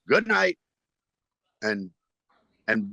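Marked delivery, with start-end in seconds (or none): 0.1-0.6 high, quick stage farewell; abrupt sign-off. Retold or imitated. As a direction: call it out to cut the show short. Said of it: "I just went".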